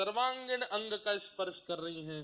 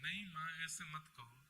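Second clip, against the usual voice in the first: put-on softer, tearful child's plea; weeping plea; 3 semitones lower, 11 dB quieter than usual. Krishna weeps as mother disowns him, softer, tearful. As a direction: choke it out through tears. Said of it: "Thakur जी रोने लगे".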